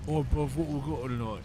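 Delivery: a slurry British drunk, lightly put on; half-hearted tipsy British bloke; drunk British accent. Comedian imitating a wasted British guy at the door, mild British accent. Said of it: "I'm trying to do a drunk British".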